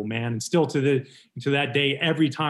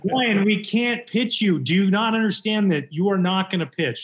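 mock the fed-up boss laying down the law; exasperated refusal; 6 semitones higher, 3 dB louder than usual. Manager refusing to let his player pitch, exasperated.

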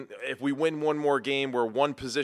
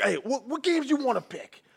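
a lightly voiced, high desperate bargainer; mocking Phil's desperate voice; 5 semitones higher than usual. Son mimicking his father begging for more picks. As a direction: imitate him pleading to change the rules. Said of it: "That's how you sound".

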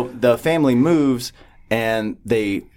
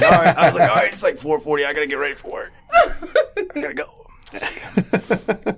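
laughing; 7 semitones higher than usual. Retold or imitated. Joking goodbye before leaving for work.

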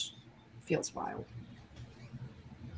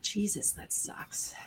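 whispered; 3 semitones higher, 12 dB quieter, slower than usual. Real, not acted. Sympathising over work dinner.

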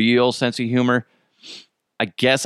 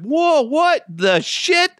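high, slow startled exclamation; high, drawn-out mock alarm; startled whoa; much slower than usual. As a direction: drag it out in shock. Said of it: "And I'm like".

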